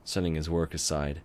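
plain reading voice

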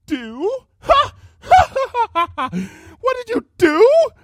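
high, loud maniacal cackle; mad laughter. Acted. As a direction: laugh like a madwoman.

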